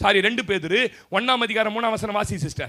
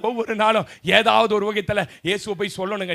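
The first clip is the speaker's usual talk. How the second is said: ordinary delivery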